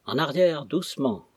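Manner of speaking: plain reading voice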